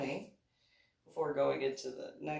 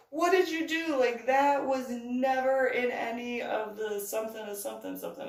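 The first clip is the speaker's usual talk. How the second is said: higher, louder puzzled complaint; baffled worker; 7 semitones higher, 6 dB louder than usual. Retold or imitated. Ride worker questioning them, baffled. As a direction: sound baffled and accusing. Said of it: "he was like".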